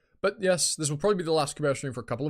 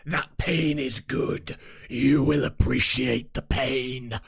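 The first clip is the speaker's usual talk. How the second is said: very slow, booming drawn-out delivery; slow, louder, hammed-up proclamation; mock motivational decree; 5 dB louder, much slower than usual. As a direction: stretch each word like an overblown drill master. Mock motivational exercise speech.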